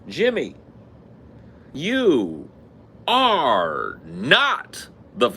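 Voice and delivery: high, loud, slow dramatic reveal; Maury reveal. Retold or imitated. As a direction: drag out the reveal like a talk show host. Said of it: "In the case of".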